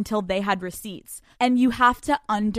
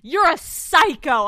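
mock exasperated partner; 5 semitones higher, 7 dB louder, slower than usual. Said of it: "He's like".

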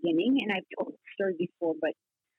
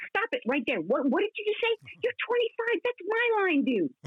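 mock indignant; 10 semitones higher than usual. Father scolding son's forgetfulness joke.

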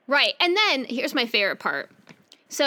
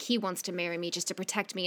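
much softer, lower mock confiding; hushed aside; 6 semitones lower, 9 dB quieter, faster than usual. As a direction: drop it to a near-private murmur. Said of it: "'Cause she's like".

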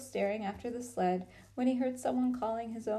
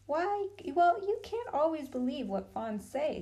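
know-it-all; 6 semitones higher than usual. A narrator's reading. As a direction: sound as if you know far more. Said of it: "trying to sound as if he knew far more".

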